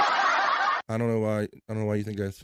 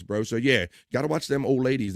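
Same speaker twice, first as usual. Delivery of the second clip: ordinary talk, nothing marked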